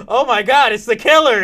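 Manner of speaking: loud, high shriek of discovery; mock panic. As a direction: panic like a horror victim. Acting out victim seeing the killer.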